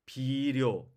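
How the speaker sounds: 비료 with a Korean tapped R; Korean pronunciation. Shown as a demonstration. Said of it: "한국말로 비교해 볼게요".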